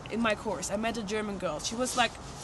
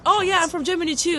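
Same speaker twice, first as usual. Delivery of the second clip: high, loud, bubbly announcement; retold excited gush; eager reply; 7 semitones higher, 6 dB louder than usual. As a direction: chirp it out, delighted. Eager reply to the German girl.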